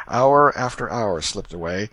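usual speaking voice

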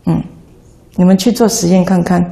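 ordinary talk

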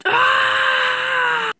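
high, sustained cry; long scream. Acted. Fired worker reacts to bad news, long high scream. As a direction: hold one long high wail.

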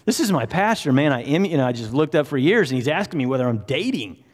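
mock-scandalized outburst, starting high; flabbergasted. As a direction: act out flustered disbelief. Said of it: "she's just flabbergasted".

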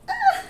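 sudden high yelp; startled high yelp. Real, not acted. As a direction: yelp as the books slip. Books toppling.

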